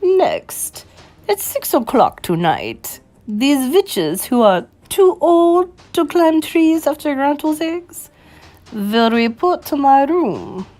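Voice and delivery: put-on commanding accented witch addressing her coven; imperious witch giving instructions; Grand High Witch. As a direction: dictate orders as a witch leader, stretching key words. Villain's speech in a story.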